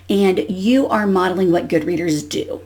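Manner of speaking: ordinary delivery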